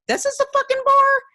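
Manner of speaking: squeaky high, outraged disbelief; a high incredulous yelp at the bar; mock disbelief. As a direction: shoot way up in pitch as if scandalized.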